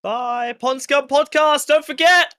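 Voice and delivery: high, shouted podcast promo; loud plug. Pretend. Plugging the live podcast to passers-by, loud shout. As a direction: call it out loud and high like a hype announcement.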